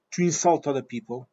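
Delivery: ordinary delivery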